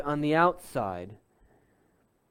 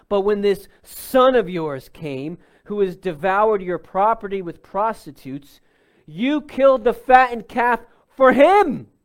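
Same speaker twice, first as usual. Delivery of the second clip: rising higher and louder to the end; resentful anger; 4 semitones higher, 8 dB louder than usual. Retold or imitated. Older son complaining to his father, mounting anger. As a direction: build the outrage toward the last words. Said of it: "the anger and the disdain".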